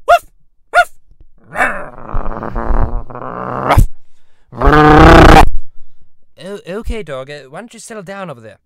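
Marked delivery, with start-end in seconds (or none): 0.1-5.4 loud barks, the last one a big deep bellow; dog barking. Acted. Dog the Bounty Hunter barking at the panel. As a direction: bark like an excited dog, louder each time. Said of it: "we got Dog the Bounty Hunter".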